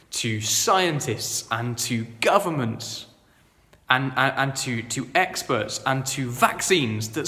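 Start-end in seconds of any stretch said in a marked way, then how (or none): none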